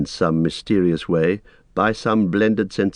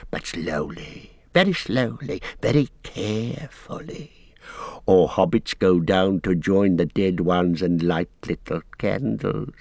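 slow, whispery creature warning; Gollum voice. Acted. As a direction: creep through each word. Gollum warns hobbits.

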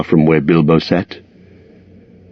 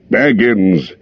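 exclaiming; 3 semitones higher than usual. A narrator's reading. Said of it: "he exclaimed".

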